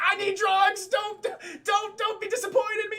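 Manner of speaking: high-pitched mock shout; hammed-up frantic begging of an addict; desperate plea. Desperate addict begging in retold film.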